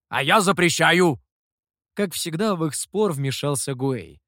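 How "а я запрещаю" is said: forbidding. A narrator's reading. Demon forbids in quarrel.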